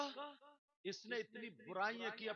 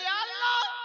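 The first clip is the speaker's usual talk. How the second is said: mock wife's cry; 16 dB louder, slower than usual. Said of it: "تو آنگن میں کھڑے ہو کے کہتی ہے".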